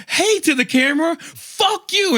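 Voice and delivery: very high mock-greeting into a curse; retold indignant singsong comeback; defiant echo. Passenger answers driver's camera taunt, defiant and high. Passenger mockingly echoes, then swears.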